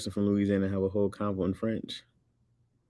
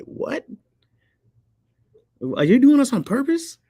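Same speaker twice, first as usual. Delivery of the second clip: high-pitched incredulous questioning; exaggerated high, baffled outsider; mock bewilderment; 8 semitones higher, 5 dB louder than usual. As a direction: squeak it in comic confusion, easing down gradually. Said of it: "y'all all be like".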